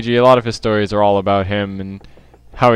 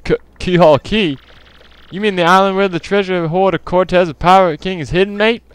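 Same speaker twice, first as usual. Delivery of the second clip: higher-pitched put-on Australian accent; mock Australian accent; 9 semitones higher than usual. Townsperson warning of a pirate curse, mock Australian accent. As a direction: throw "mate" on the ends, faking Australian. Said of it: "I'm trying to speak Australian".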